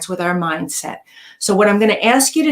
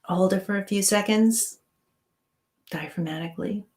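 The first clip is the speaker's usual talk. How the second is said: guided meditation; 4 dB quieter than usual. Acted. Guides breathing and relaxation.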